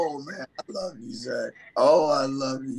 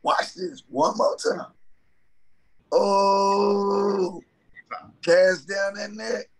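building excitement; 6 semitones higher, slower than usual. Retold or imitated. Casting net dramatically.

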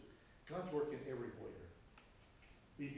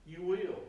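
"You will" punched, both words leaned on, a bit louder; emphatic you will; 3 semitones higher, 4 dB louder than usual. Shown as a demonstration. Preacher insists to the congregation, stress on "You will". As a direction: land "You will" hard, as a firm promise. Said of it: "that is an emphatic "you will".

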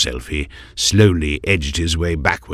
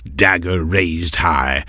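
usual speaking voice

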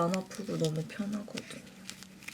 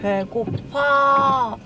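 whiny; 3 semitones higher, 7 dB louder, much slower than usual. Real, not acted. Drawn-out hungry whine.

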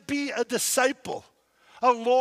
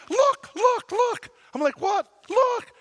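much higher and louder, eager pointing; excited mom; 12 semitones higher, 4 dB louder, slower than usual. Retold or imitated. Mom urging her son to look at the mountain, excited high calls. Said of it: "she's like".

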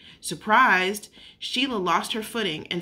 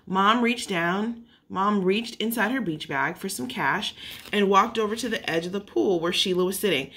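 ordinary delivery, nothing marked

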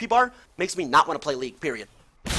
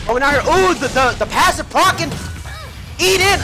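building shoutcaster call, louder and higher; excited play-by-play; 6 semitones higher, 5 dB louder than usual. Real, not acted. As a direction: build excitement as the fight starts. New play starts in match.

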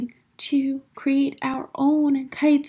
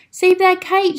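high, loud urgent call; storybook shout; 6 semitones higher, 6 dB louder than usual. A narrator's reading. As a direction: shout for help as Mole. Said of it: "shouted Mole".

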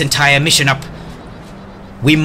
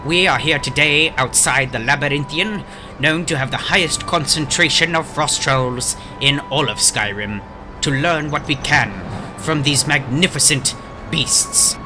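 nature documentary; 5 dB quieter than usual. Acted. Reporter intro with documentary narration.

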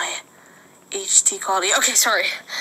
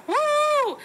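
high cheer. Pretend. Team cheer.

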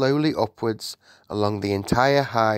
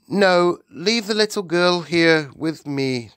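high regal command, loud first word; firm Queen; 8 semitones higher, 4 dB louder than usual. Acted. Queen keeps the girl with her, firm. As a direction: countermand it in a raised royal voice.

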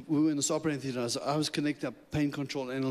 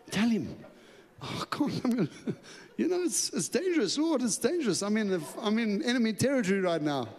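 much higher, anxious plea to God; panicked pleading; 8 semitones higher than usual. Retold or imitated. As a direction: beg the Lord nervously, half laughing.

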